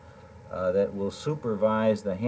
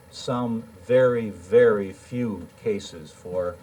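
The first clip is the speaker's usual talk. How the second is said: slow, weighted stress on Some; emphasis on some; 3 dB louder, slower than usual. Real, not acted. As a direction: lean hard on Some and very, slowly. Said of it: "I put the emphasis in that statement on the word some".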